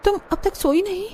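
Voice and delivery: put-on high-pitched, exasperated young wife grumbling; complaining village daughter-in-law. (परेशान बहू की शिकायती आवाज़)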